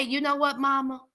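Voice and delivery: tender, grateful pretend apology; sweet apology. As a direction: coax Mama with thanks and a little money. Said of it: "You can say".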